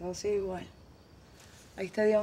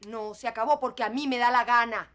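louder, firm and final; defiant declaration; 7 dB louder than usual. Acted. Mother tells her daughter it's over because she says so, defiant. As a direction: slam down the decision.

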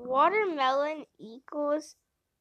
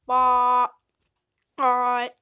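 loud bleating; 8 dB louder than usual. Acted.

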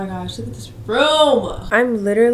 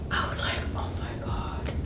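soft whispered amazement; whispered wow; 11 dB quieter than usual. Retold or imitated. Retelling the tingling conditioner, whispered wow.

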